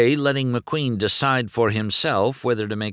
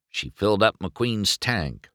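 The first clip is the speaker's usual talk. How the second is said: usual speaking voice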